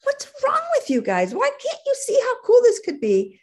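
very high, exasperated pleading; high-pitched, worked-up appeal to the guys; voice going up. Retold or imitated. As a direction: re-enact losing it, pitch climbing right up. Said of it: "My voice went up".

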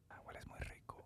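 soft unvoiced whisper; barely breathed, close to the ear. Shown as a demonstration. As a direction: seductive whispered compliment. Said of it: "let me clear my nostrils".

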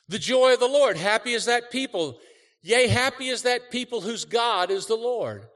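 high, loud joyful preaching. Acted. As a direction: lift the crowd, shouting praise. Preaching burst.

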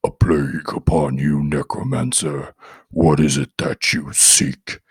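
low, slow demonic rasp; deep demon voice. A narrator's reading. Demon inside the boy answering the necromancer, low and slow. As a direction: speak as a hostile demon from below. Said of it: "A deep voice barked".

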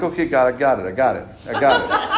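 chuckling I-got-it concession; laughing, caught out; laughing surrender. Acted. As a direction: give in with a laugh. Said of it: "And I'm like".

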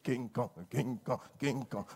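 slow, low mock chant of King Kong; lightly mocking insult chant; mocking nickname. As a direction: taunt him like a big ape. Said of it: "That's how you talk to him".

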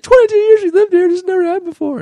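put-on high, loud old lady voice; squeaky put-on neighbor voice; imitating neighbor. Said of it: "she's like".

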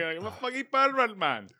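high mock Nepalese accent; laughing at his own Nepalese accent impression; Subas impression. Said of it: "Subas's accent".

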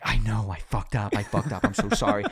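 loud, frantic mock apology; exaggerated, apologetic fretting; retold panicked apology. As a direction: replay a flustered plea for forgiveness. Acted panicked apology to angry stepmom.

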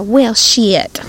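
imitated country drawl on both words; country accent. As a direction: stretch the vowels like a country Texan. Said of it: "a little bit more country than that".

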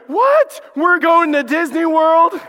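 high, loud excited squeal; excited kids. Retold or imitated. Kids shouting to the pastor, thrilled and surprised. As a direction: shout it like kids hearing big news. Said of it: "the kids turned and went".

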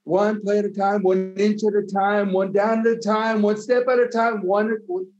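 rally the team like it's fourth and inches; a locker-room speech that builds from firm to roaring; coach's pep talk. Pretend. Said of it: "Can you give us your best coach's speech?".

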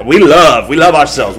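ordinary talk

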